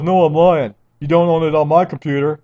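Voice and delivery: mocking the office guy. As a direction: parody the clerk's smug reply. Mocking the office guy's reply.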